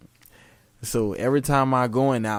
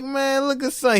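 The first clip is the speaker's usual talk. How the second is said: amused exclaim; 13 semitones higher than usual. Retold or imitated. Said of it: "I'm like".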